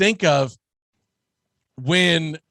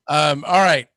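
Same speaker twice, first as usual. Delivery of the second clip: normal talking voice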